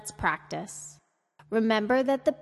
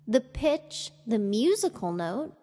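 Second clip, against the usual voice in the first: ordinary talk, nothing marked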